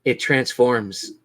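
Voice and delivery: slow, soft dramatic scene setting; game master's eerie narration; ominous narration. Acted. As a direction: draw out the reveal darkly.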